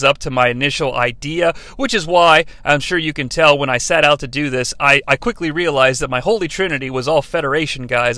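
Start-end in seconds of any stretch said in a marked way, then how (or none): none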